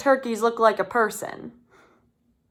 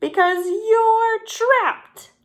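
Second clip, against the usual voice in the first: high, loud, slow gloating shout; triumphant shout; 12 semitones higher, 4 dB louder, slower than usual. A narrator's reading. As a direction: crow it out, drawn long. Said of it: "Ollie shouted".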